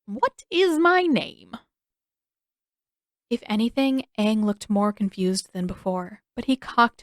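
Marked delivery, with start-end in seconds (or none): 0.0-1.6 much higher, slow, odd teasing; teasing riddle. A narrator's reading. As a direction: stretch each word, a mad old king. King's slow riddle again.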